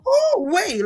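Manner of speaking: high-pitched retold "whoa" moment; amazed reaction. Reliving the smell on that clothes, amazed. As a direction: pitch it way up, savoring the surprise.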